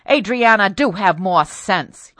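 loud, slow reprimand; stern father. Acted. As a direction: shake sense into her. Father shakes daughter.

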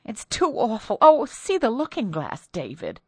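high, rising dread; frightened wife. Acted. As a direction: point at the glass in horror. Wife terrified by broken glass.

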